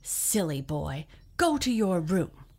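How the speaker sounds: rising to a loud angry scold; furious mother sending him off. Acted. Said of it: "she was very angry".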